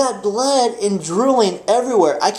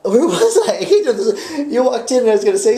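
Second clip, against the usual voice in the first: laughing as he loses track; 5 semitones higher, faster than usual. Real, not acted. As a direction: chuckle through the question.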